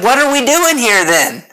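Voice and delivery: imitated youth's high, indignant question; mock disbelief, pitched well up; baffled protest. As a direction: act out a let-down kid protesting. Acted baffled youth at conference.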